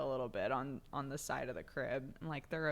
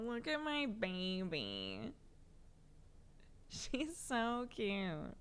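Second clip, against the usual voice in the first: slow, higher tender coo; soft doting voice; 7 semitones higher, 3 dB quieter, much slower than usual. Real, not acted. Cooing over baby photo.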